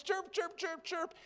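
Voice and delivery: very high chirped chirp, chirp; high bird chirps. Acted. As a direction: chirp it like an excited little bird. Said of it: "he began to chirp".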